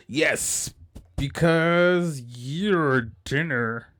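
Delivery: slow, high, menacing villain voice; unhinged villain. Acted. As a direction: savor each word like a predator. Villain line in anime preview.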